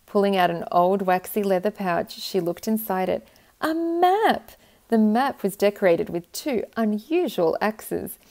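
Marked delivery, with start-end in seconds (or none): 3.6-4.5 excited discovery. Acted. Purr finds a map.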